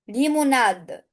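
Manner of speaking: normal talking voice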